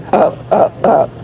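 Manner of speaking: ordinary talk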